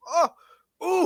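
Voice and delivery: loud, high "oh, ooh"; imitated wincing cries. Host reenacting his reaction to leg kicks, wincing cries. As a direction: yelp as if watching brutal kicks.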